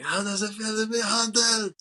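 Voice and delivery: high-pitched imitated action-hero taunt; mock movie shout. Acts out classic movie taunt.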